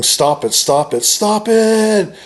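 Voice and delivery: imitated yell, rising high on the last one; retold yelling. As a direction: build to a drawn-out, high mock yell. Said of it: "he just starts yelling".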